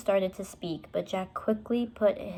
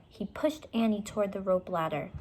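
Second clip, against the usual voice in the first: ordinary delivery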